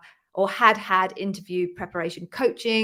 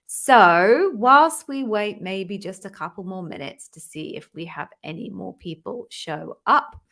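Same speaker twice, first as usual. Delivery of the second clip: ordinary talk, nothing marked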